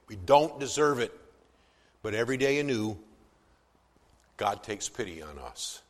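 ordinary talk, nothing marked

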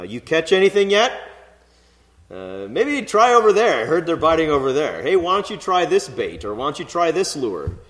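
loud, high, chummy fisherman giving advice; fisherman banter. Retold or imitated. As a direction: call over like a know-it-all angler. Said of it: "common dialogue between fishermen".

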